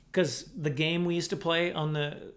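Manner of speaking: ordinary talk, nothing marked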